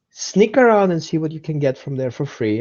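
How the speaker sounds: usual speaking voice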